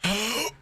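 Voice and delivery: sudden high gasp, mimicking a panicked friend; imitated gasp. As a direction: gasp as if struck mid-haircut. Imitates friend gasping in story.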